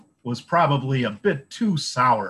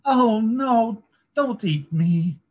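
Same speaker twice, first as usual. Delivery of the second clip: high pleading little goat; little goat crying out; 11 semitones higher, 5 dB louder, slower than usual. A narrator's reading. Littlest goat begs the troll, high and pleading. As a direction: cry out like a scared small goat. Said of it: "cried the littlest goat".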